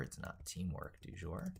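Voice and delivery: ordinary talk, nothing marked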